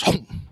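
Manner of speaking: short hollow "hum" chomp; quick swallowing noise; imitated snake gulp. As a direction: gulp it down in one sound. Imitates the snake gulping food.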